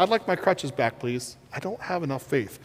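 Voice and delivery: soft, small, fading plea; a hint of a scared patient begging for crutches; meek whimpering request; timid plea. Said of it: "I kinda did one of these".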